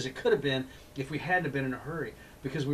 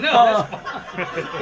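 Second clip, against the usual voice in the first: laughing reply; 8 semitones higher, 13 dB louder, much slower than usual. Retold or imitated. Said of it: "And I was like".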